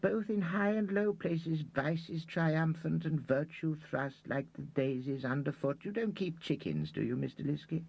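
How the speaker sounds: high, slow sanctimonious sermonizing; high preachy old man. A narrator's reading. Reader moralizing to Liskey, high and slow.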